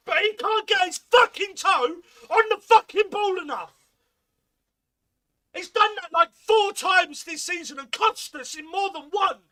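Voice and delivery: loud, high, shouted tirade; angry rant. Real, not acted. Fan ranting at Coburn for missing again, shouting angrily. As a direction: rage yelled through every line.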